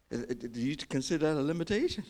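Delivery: ordinary talk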